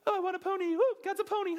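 high, squealing mock pony voice; terrible pony impression. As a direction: squeal it out like a silly kid wanting a pony. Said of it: "that was a terrible pony impression".

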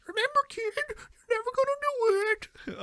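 squeaky high mock Sandler-ish kid voice. Friend mocks a possible Sandler line, squeaky high voice. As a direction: pitch way up, silly mockery. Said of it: "unless you're like".

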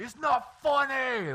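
huge, high, drawn-out roar; slow, very loud mock tantrum roar; Hulk. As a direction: bellow it like an enraged little monster. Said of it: "like the Hulk".

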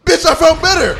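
loud, high mock shout at an ex; gloating comeback. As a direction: crow it through laughter, loud and high. Crowing back at retold ex-girlfriend.

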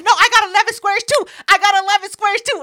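high, loud insistence; insisting. Retold or imitated. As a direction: insist over and over. Brenda insisting.

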